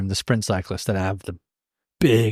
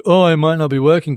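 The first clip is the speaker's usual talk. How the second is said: higher, louder retold remark; retold own reaction; 5 semitones higher, 6 dB louder than usual. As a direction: act out your earlier self, pitched up. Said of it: "And I was like".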